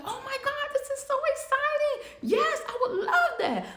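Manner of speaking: a wife squealing over her dream job offer, laughing; thrilled wife. Retold or imitated. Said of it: "And she is so excited".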